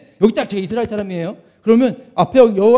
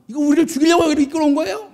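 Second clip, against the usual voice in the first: quick, higher-pitched retold protest; mock railing; 6 semitones higher, faster than usual. Israelites railing at Moses by the sea, mock railing. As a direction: rattle off the accusation without real volume. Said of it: "막 가진 악다구니를 막 쏟아내기 시작합니다".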